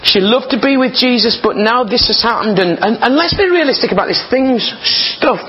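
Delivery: high-pitched, animated sermon delivery; impassioned preaching. Real, not acted. Preacher reasons about Mary's disappointment, impassioned. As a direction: preach it pitched well up, urging realism.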